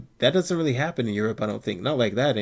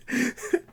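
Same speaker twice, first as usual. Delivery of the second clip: a quick giggle much higher; 12 semitones higher than usual. Real, not acted. Laughing at the absurdity of the insults, a high giggle. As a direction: giggle in disbelief.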